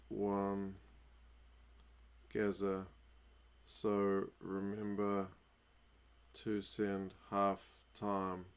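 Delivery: low, quiet, drawn-out words with long gaps; slow, absent dictation. Real, not acted. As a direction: murmur it word by word, as if composing a message. Dictating a message word by word.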